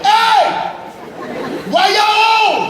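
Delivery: put-on very high, loud, childish shout; eight-year-old yelling to his parents up front; kid yelling. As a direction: holler it from the back seat. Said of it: "I just yelled to the front seat".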